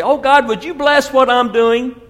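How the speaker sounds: hammed-up pleading prayer voice; mock prayer. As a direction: beg God like a self-centred believer. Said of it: "then we say".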